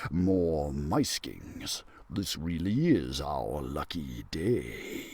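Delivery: deep, slow, far-off dragon rumble; a hungry dragon gloating over its prey; greedy dragon. Acted. Dragon eyeing the trapped mice, deep greedy gloat.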